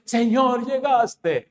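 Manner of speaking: high-pitched fake welcome; hammed-up cry of welcome; mock greeting. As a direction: greet the Lord in a high, put-on voice. Mimics abusers greeting the Lord.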